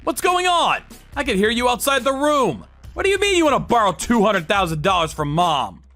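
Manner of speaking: walk in and challenge your brother; alarmed confrontation. Acted. Sibling confronts brother over loan.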